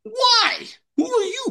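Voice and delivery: very high-pitched, incredulous retort; mock indignant challenge. Pastor voices the person being chased, mock indignant. Imagined listener challenges his order.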